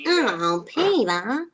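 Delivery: lightly done Lois voice; Lois impression. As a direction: lift the name like a cartoon wife. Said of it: "sounded just like Lois".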